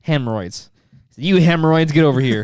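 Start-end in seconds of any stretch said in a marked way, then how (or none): 1.2-2.4 raised-voice mock dad; imitated dad calling. Son imitating his dad calling the kids over, raised voice. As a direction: call them over, imitating a gruff order. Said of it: "You know what he called him".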